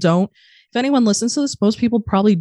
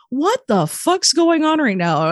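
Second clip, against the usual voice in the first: high, drawn-out disbelief; slow, high-pitched incredulous question; retold bafflement; 9 semitones higher, slower than usual. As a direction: act out being utterly baffled. Retold bafflement at parents' kindness.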